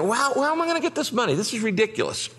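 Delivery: high, fast worried exclaim; retold panic. Pastor panicking over the money, high fast worry. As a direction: sound overwhelmed by the sum.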